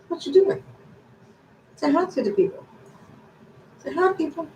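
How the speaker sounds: high sing-song coaxing. Real, not acted. Asking the dog to say hi, high coaxing coo.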